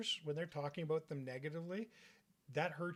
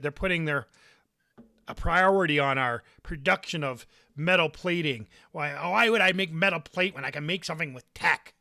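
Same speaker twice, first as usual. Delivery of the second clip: gruff father; 13 dB louder than usual. Acted. GM voices the father.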